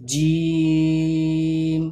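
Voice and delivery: জিম held very long, tongue pressed; long pressed jim. Shown as a demonstration. Teacher modelling the long pressed jim for pupils. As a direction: stretch জিম out for three seconds. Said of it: "শক্ত করে চেপে বলবো".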